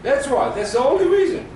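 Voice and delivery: high and loud, hammering the point home; emphatic insistence. Real, not acted. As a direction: insist it's the only reason. Teacher insisting on the reason.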